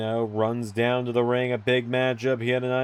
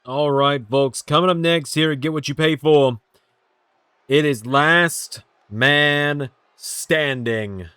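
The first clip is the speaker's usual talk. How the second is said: ring announcer; 2 semitones higher, 3 dB louder, slower than usual. Acted. Hypes the next match.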